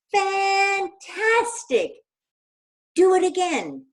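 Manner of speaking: high, loud, stretched cheer; a long, loud, high shout. Acted. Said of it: "Elizabeth shouted".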